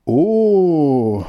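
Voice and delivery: long, loud, high falling oh; surprised. A narrator's reading. Hare waking to spring, loud high surprise. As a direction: gasp it out as a drawn-out oh. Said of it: "ganz überrascht".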